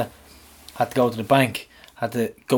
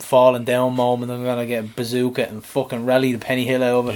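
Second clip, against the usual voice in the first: ordinary talk, nothing marked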